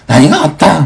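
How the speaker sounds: panicked daughter. Retold or imitated. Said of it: "もうテンパってるから".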